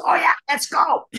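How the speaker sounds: high, eager mock voice; a hammed-up eager Bobby; imitating Bobby. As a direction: jump in way up, keen to start. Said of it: "and Bobby goes".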